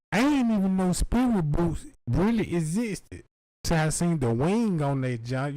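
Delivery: ordinary talk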